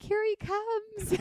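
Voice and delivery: high, drawn-out dramatic cry; overdramatic. Retold or imitated. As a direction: gush it slowly, high and overcome. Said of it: "I'm so dramatic".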